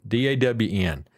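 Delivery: ordinary delivery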